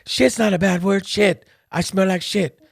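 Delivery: high, exasperated daughter voice, the first line slow; a hammed-up high-pitched scolding; imitating sister. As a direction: scold the dad in a high voice, then the dad cuts back in. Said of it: "She's like".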